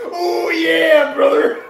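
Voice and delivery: loud high growled oh yeah brother; over-the-top wrestling promo yell; wrestler catchphrase. Acted. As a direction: shout it like a macho wrestler. Randy Savage catchphrase impression.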